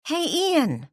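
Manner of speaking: bright call across the room; excited greeting. A narrator's reading. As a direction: call out his name happily. Calling out to a friend.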